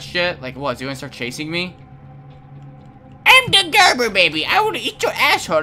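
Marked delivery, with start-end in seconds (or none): 3.3-5.6 put-on much higher, louder monster baby with a laugh; creepy baby voice. Imagining the baby chasing him, creepy baby voice. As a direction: play a demonic Gerber baby.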